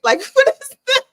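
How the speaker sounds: high, laughing "what is that"; laughing disbelief. Real, not acted. Mocking the way they talk.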